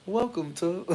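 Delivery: mock code-switched professional greeting; imitated corporate greeter. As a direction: put on a customer-service voice. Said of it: "they sound, like, way more like".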